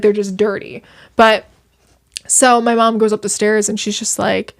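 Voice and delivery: usual speaking voice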